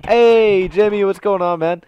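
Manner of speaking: loud, high-pitched hail to a buddy; hearty greeting. Real, not acted. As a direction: call out to a friend joining in. Calling out a greeting to Jimmy.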